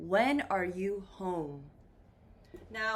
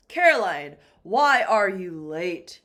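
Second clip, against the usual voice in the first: loud boss calling out an employee; hammed-up boss questioning, much louder; demanding boss; 3 semitones lower, 11 dB louder than usual. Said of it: "Your boss asks you".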